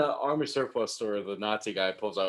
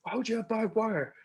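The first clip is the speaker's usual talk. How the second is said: high-pitched outraged complaint; imitating a worked-up movie character; acted indignant rant; 10 semitones higher than usual. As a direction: play an aggrieved man protesting, voice pitched up. Acting out film hero's barbed-wire rant.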